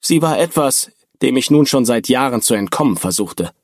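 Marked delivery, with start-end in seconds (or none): none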